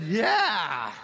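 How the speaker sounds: a loud gloating "Yeah" that cracks; voice cracking shout. Pretend. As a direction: shout the "Yeah" till the voice breaks. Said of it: "my voice just cracked".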